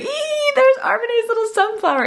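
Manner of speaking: high-pitched mock squeal of delight; excited squeal. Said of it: "We were like".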